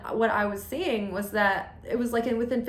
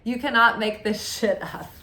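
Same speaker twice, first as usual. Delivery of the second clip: ordinary talk, nothing marked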